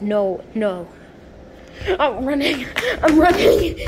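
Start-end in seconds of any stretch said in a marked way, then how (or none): 2.0-3.8 laughing in panic, rising higher; nervous laugh while fleeing; panicked laughing. Real, not acted.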